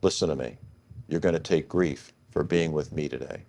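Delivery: mild imitation of a president's firm, hushed insistence; quiet, earnest warning. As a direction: lean in and press the point quietly. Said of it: "He goes".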